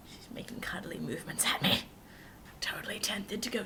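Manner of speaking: hushed whisper so she won't hear; a secret aside in a whisper. Real, not acted. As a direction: whisper it behind her back. Housemate nearby, whispered aside.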